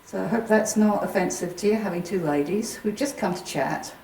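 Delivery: addressing spirits. Acted. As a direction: speak gently into the dark room. Calls out to spirits.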